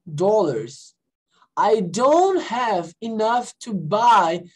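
slow, careful English dialogue read for learners; slow model reading. Shown as a demonstration. Teacher reading Nina's dialogue to the class, slow model reading. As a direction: read it out word by word, clearly spaced. Reads lesson dialogue slowly.

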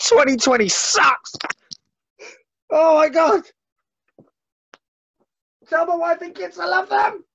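wounded soldier gasping. Acted. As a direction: wail your last words melodramatically. Doctor dying of the virus.

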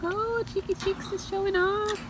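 high sing-song voice cooing to the birds. Real, not acted. Owner cooing at her parrots on their swing, high sing-song baby talk.

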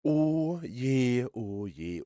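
ordinary talk, nothing marked

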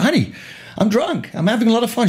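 high, giddy tipsy voice; cheerful drunk. Retold or imitated. A tipsy husband on the phone to his wife, giddily high. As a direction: play it happily tanked.

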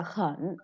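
"khẩn" with the whole dip down and back up; full third tone. Shown as a demonstration. Teacher showing the word standing alone, "khẩn" in full third tone. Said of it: "đứng một mình thì mình sẽ đọc là".

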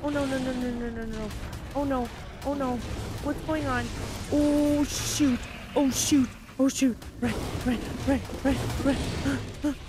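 rising panic, high and loud; frantic shouts to flee; panicked yelps. Real, not acted. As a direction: yelp in real fright as they swarm you. Dinosaurs suddenly attack her.

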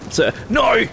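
frustrated shout. Real, not acted. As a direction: yell at the screen in frustration. Frustrated yelling at game.

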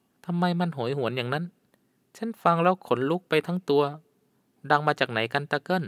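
put-on uneasy young woman. A narrator's reading. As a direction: ask the old guide, lightly spooked. Frightened young woman asks guide. (หญิงสาวเสียงสูง หวั่นใจเล็กน้อย)

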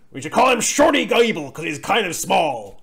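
loud mocking boss voice; mock upper-management voice; put-on executive voice. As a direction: send up a bigwig delighted with his own jibe. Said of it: "doing that particular voice".

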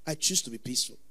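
the believer's inner resolve, hushed; inward calm. Retold or imitated. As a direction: say it quietly to yourself. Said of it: "but inwardly said".

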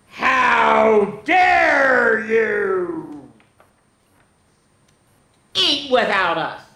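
very slow, drawn-out shout; outraged exclamation. Acted. Papa scolding the intruder, drawn-out exclamation. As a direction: stretch it out in mock outrage. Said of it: "exclaimed Papa".

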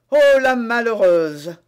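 loud, slow outcry; exclamation indignée. A narrator's reading. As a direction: cry out, stretching the words. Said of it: "s'écria le commissaire". (s'écrier lentement et fort)

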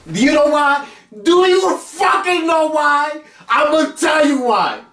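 rising rant that peaks in a shout; building hype shout. Acted. Host hyping up his own show.

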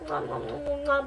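whispered kissing smacks; air kisses. Real, not acted. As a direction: blow a string of kisses at the camera. Blowing kisses to friends.

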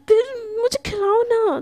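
put-on high, loud childish whine, half-crying; a pouty high whimper asking to be fed; whiny pleading. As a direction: beg like a spoiled child wanting to be fed. Prachi begs to be fed.

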